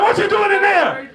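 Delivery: calling through door. Retold or imitated. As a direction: call out to the kid.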